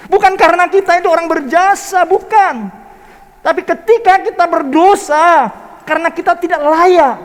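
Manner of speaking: fiery pulpit climax; preaching at full force. Acted. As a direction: thunder the gospel to the congregation.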